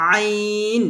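আইন drawn out slowly from the middle of the throat; slow throaty আইন, sound it clearly; Arabic ain demo. Shown as a demonstration. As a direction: stretch আইন long and pressed from mid-throat. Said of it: "এই দুটি হরফ আমরা কণ্ঠনালীর মাঝখান থেকে উচ্চারণ করি".

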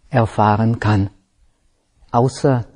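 ordinary delivery

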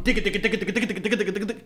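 quick percussive sputter of syllables; imitated rapid hits. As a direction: rattle it off like a flurry of punches. Mimics rapid blows on beanbag.

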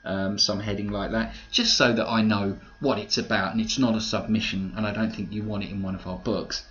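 normal talking voice

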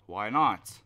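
ordinary talk, nothing marked

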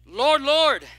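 loud, high plea of Lord, Lord; pleading cry. Acted. Pleading sinners at judgment.

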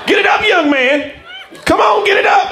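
high-pitched coaching yell; imitated high cheering; mock spotter encouragement. Spotter urges him on the bench.

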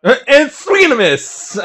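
high, drawn-out play-by-play call; stretched commentator voice; mock sportscaster call. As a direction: call the whiff like an announcer, slowly.

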